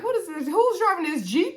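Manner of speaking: high-pitched, incredulous questioning; puzzled surprise. Retold or imitated. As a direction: act out your baffled reaction, pitched high. Acting out puzzled reaction to Jeep.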